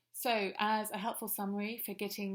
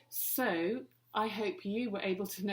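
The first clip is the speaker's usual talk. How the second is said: ordinary talk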